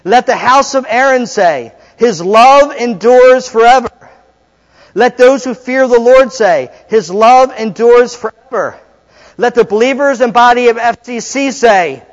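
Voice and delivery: loud, high, drawn-out liturgical call; call-and-response leader. Acted. Preacher leading the congregation in a responsive psalm, loud proclamation. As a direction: proclaim it so the whole congregation answers.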